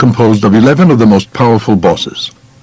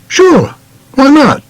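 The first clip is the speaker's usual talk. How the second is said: higher, breezy mock-casual yes; lightly voiced young self agreeing higher; casual acceptance; 14 semitones higher, slower than usual. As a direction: toss off the answer like it's no big deal. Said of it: "So I say".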